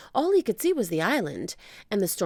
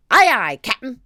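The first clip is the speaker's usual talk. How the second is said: loud, higher aye aye; pirate shout; 7 semitones higher, 11 dB louder than usual. Acted. Pirates answer the captain, loud. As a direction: crew answering sharp.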